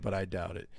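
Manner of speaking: ordinary delivery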